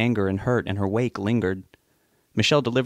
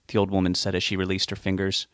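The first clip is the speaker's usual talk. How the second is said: usual speaking voice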